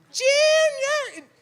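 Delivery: put-on high, loud kid's yell; kid's shout. Little fan calling to his hero, high desperate shout. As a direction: call out like a desperate young fan. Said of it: "You're shouting".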